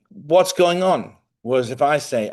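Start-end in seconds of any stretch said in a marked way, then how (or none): 0.1-1.2 higher, louder, drawn-out demand; retold exasperation at a vague answer; exasperated questioning. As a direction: demand an answer in mock frustration. Retold exasperated questioning of his wife.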